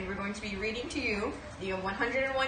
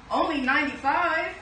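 louder and higher, alarmed exclamation; dismayed surprise; 6 semitones higher, 6 dB louder than usual. Acted. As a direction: realize puppies are missing. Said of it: "quiet counting then exclaiming".

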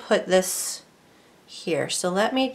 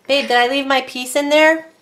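calling out; 5 semitones higher, 6 dB louder than usual. Real, not acted. Calling to partner in kitchen.

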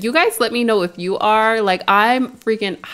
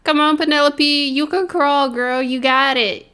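coaxing; 7 semitones higher than usual. Real, not acted. Coaxing baby to crawl.

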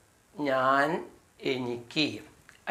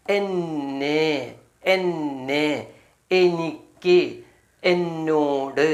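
very slow, each meaning drawn out for learners; slow drill; much slower than usual. Shown as a demonstration. Teacher spelling out the meanings of me to students, very slow. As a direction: stretch every word so the class can write it down. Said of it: "എന്താണ്?".

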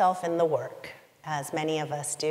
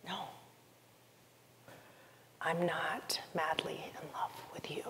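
soft unvoiced whisper; whispered admission; 11 dB quieter than usual. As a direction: breathe it out as a secret you dread. Voicing her own painful answer.

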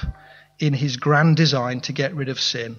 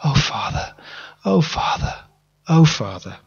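half-whispered then rising call, repeated; mild repeated cry of grief; repeated grieving plea. Retold or imitated. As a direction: murmur it over and over in grief. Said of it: "I said it over and over".

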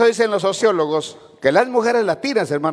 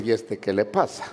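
puzzled aside; 7 semitones lower, 5 dB quieter than usual. Retold or imitated. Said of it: "Van a decir".

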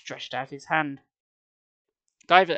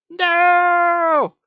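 long, much higher, loud wail; horrified wail; 10 dB louder than usual. A narrator's reading. Nyla wailing as her pendant shatters, horrified wail. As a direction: wail in horror at the loss. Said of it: "Nyla's horrified wail".

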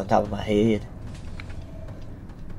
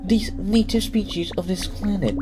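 higher, calm alien captive; friendly alien voice; 9 semitones higher, 4 dB quieter, slower than usual. Acted. A captured alien reassuring the player, lightly higher. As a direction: speak as a gentle fellow prisoner. Voicing another alien creature.

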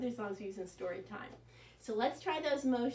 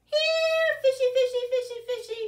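high, loud sing-song call of fishy, fishy; 13 semitones higher, 10 dB louder than usual. Acted. Boy calls the whale in the pool, loud and high. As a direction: call the whale like coaxing a pet.

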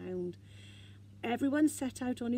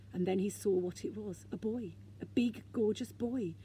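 plain reading voice

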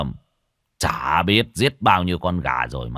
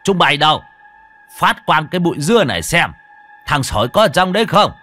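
higher, louder commanding call; loud shout; 7 semitones higher, 7 dB louder than usual. A narrator's reading. Old man shouting for the thornbush to be cleared, loud. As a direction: bark orders to clear the bush. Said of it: "Cuối cùng lão hô to".

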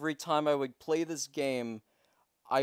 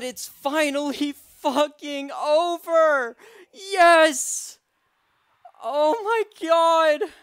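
loud, high victory yells; ecstatic shouting; 9 semitones higher, 8 dB louder, slower than usual. Real, not acted. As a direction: scream it out in triumph. Run finally beaten, celebrating.

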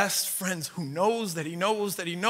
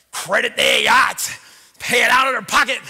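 emphatic shout; 6 semitones higher, 13 dB louder than usual. Real, not acted. Preacher shouts out for the students who paid their own way, loud and emphatic. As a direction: hammer the point home.

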